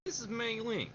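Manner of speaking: put-on briefing voice in a wavering accent; Colonel in a slipping accent. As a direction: Colonel introducing Mei Ling, accent drifting. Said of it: "Slipping in and out".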